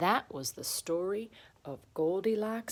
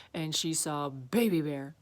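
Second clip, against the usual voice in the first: ordinary delivery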